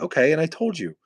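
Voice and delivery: ordinary delivery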